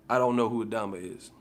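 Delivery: ordinary talk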